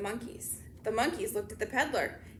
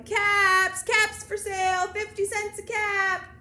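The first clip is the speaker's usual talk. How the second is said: loud, high peddler cry, held level and stretched; street vendor's call; 6 semitones higher, 7 dB louder, slower than usual. A narrator's reading. Peddler hawking his caps along the street, calling out. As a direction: call out wares down the street, slowly. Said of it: "walked down the street calling".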